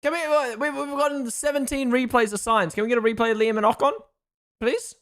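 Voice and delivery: high, insistent begging; real frustrated appeal; exasperated pleading. Real, not acted. Begs for a replay.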